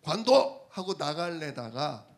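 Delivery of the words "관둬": a loud, curt "관둬!"; angry outburst. Retold or imitated. Preacher retells snapping at a rude shopkeeper, loud. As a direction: snap it out in annoyance. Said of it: "저도 기분이 나빠가지고요".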